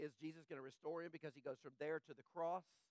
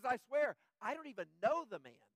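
louder, insistent sworn denial; Peter's loud oath; 3 semitones higher, 8 dB louder than usual. Retold or imitated. Peter swears loudly to the accusers he doesn't know Jesus. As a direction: swear it out loud, pushing back. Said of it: "He says".

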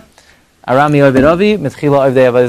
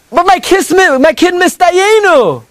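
much higher, slow fretful voice; mock worried parent; 13 semitones higher, slower than usual. Teacher voices a worried parent, high and fretful. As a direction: fret like a nervous father.

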